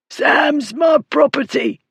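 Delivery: high, slow, strained shout; strangled, high-pitched protest; hoarse cry. A narrator's reading. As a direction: cry out hoarsely to claim what is yours. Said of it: "Bullard cried hoarsely".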